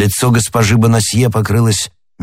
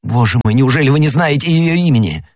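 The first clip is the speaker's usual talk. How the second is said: insistent questioning; 5 semitones higher than usual. A narrator's reading. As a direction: pitch climbing in disbelief. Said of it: "допытывался Д'Артаньян". (отчаянный, настойчивый расспрос)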